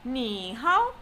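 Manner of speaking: scoop "Ni hao" down and up; slow demonstration of dipping "Ni hao"; falling-rising tone. Shown as a demonstration. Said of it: "falling rising tone".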